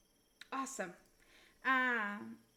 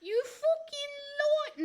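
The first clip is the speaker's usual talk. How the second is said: attempted New Jersey accent, drawn-out vowels, swooping high; hammed-up, slow New Jersey accusation; 5 semitones higher, 4 dB louder than usual. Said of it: "I can do New Jersey".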